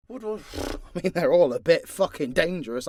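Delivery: usual speaking voice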